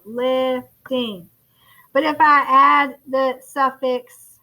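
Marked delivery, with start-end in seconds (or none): 0.0-1.3 "lifting" split into two beats, lif, ting; syllable by syllable. Shown as a demonstration. Teacher counts syllables for class, "lifting" in two beats. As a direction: say "lifting" with a gap between syllables. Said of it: "It's two syllables".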